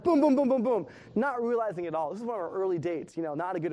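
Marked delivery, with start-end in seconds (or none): 0.0-0.9 loud boom boom stomping on an imaginary brake; imitated stomping. Nervous boyfriend stomps his imaginary brake, loud mock booms. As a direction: stamp out each boom. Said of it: "the whole time I was like".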